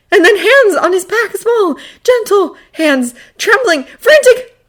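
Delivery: high, urgent, emotional narration; breathless high-pitched urgency; frantic. A narrator's reading. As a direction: narrate on the edge of tears. Narration mimics frantic rescuer.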